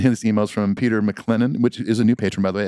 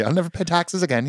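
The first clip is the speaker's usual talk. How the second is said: a hint of the medieval British knight voice; mock medieval British; 8 semitones higher than usual. Co-host joining the bit in a light medieval British accent. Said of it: "from medieval British".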